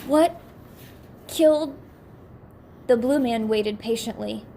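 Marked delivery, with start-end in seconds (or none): 0.1-1.8 breaking voice. Acted. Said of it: "voice seemed to be breaking through a shell".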